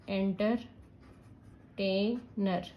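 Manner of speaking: "Entertainer" very slowly as enter, tai, ner; split into syllables. Shown as a demonstration. Teacher adding ER while spelling, split into syllables. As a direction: pause between the parts of "entertainer". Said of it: "अगर मैं ER भी add कर रही हूँ".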